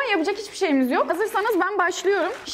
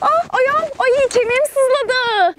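pained cry; 9 semitones higher, 4 dB louder, much slower than usual. Real, not acted. Hurt foot climbing down.

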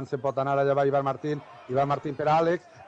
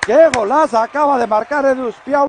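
goal shout; 4 semitones higher, 6 dB louder than usual. Real, not acted. Goal call.

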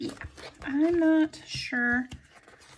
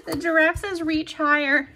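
usual speaking voice

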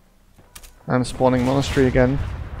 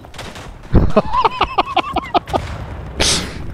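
high-pitched burst of laughter. Real, not acted. Laughs after a game moment.

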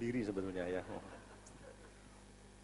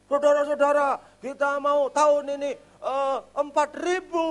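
high, loud pulpit proclamation; grand announcement; 10 semitones higher, 8 dB louder than usual. Retold or imitated. Pastor proudly announces a target of four thousand, loud and high. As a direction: sell a big vision to the crowd.

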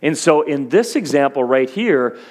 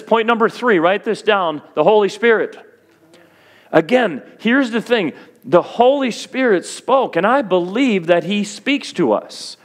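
higher, driving preacher voice building on each point; full-force preaching; 5 semitones higher than usual. Acted. Pastor pressing his third point on the church. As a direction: preach it out to the back row.

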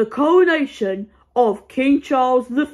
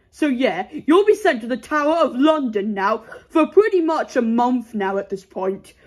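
ordinary delivery

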